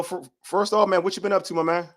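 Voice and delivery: ordinary delivery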